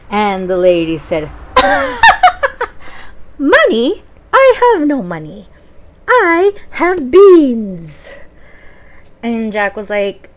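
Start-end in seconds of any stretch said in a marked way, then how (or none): 1.3-3.3 high cackling laugh; old lady cackle. Acted. Old lady laughing at Jack's question, high cackle. 3.4-8.1 put-on old lady voice.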